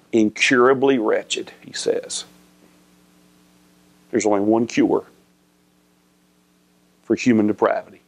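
low, soft, unhurried sermon voice; hushed preaching. Acted. Preacher softly drives home the warning, hushed. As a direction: let the words sink in quietly. Quiet, slow reflective preaching.

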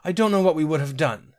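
high-pitched, overcome relief; tearful. A narrator's reading. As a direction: say it overcome with grateful tears. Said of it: "Sinetta burst into tears".

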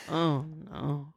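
soft mumbled oh no; sleepy mumble. Retold or imitated. Husband in the dark, mumbling softly. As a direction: mumble it half asleep. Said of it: "he goes".

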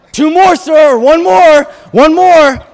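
loud, high-pitched mock warning; acted taunting countdown. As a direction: call it out high and loud, as if keeping score. Acting out counting up offenses.

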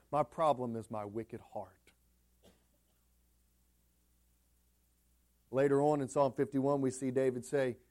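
soft, low pastoral reflection; hushed sermon voice. Real, not acted. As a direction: pull them in close, quietly. Drops into hushed confession.

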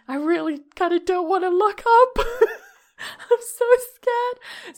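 high, strained retold fear; mock scared. Dog owner recalls not wanting to look, mock scared. Retelling her frightened thoughts at night.

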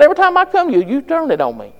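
high, aggrieved complaint; mock complaint. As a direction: whine at the preacher. Voicing his complaint to the preacher.

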